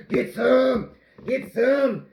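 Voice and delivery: high, loud taunt; mock battle cry. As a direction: goad the dough with playful aggression. Playfully attacking the dough.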